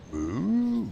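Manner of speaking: a high, hammed-up moose moo; mock moo. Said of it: "the moose on the bridge going".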